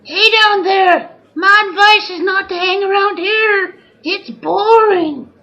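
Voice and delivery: calling out. Acted. Bored bear calls down to the symposium, loud. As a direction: heckle down at the room. Heckling from the balcony.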